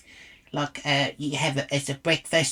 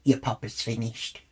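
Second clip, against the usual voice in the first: low, quieter smug imitation; 6 semitones lower, 5 dB quieter than usual. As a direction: imitate a self-satisfied stranger, pitched down. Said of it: "he smugly says".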